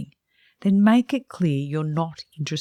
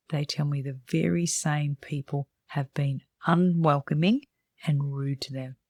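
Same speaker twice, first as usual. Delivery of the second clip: normal talking voice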